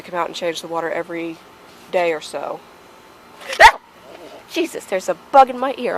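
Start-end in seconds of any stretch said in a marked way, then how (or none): 3.6-3.8 startled yelp. Real, not acted. Bee flies into her ear. 4.5-6.0 startled. Real, not acted. Bee flies into her ear.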